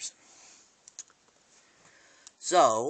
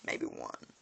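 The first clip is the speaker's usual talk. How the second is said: muttered aside; 10 semitones lower, 7 dB quieter than usual. Retold or imitated. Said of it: "He goes".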